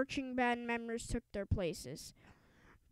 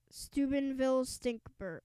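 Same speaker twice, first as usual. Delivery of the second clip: plain reading voice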